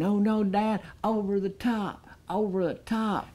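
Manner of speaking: put-on a worried boy begging his dad on; kid cheering dad. The son pleads with his dad mid-match, in a high kid voice.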